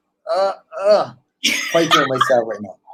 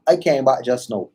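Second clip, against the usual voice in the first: Trinidadian lilt, relaxed jaw; Caribbean accent. Shown as a demonstration. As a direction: say it like a true Trini.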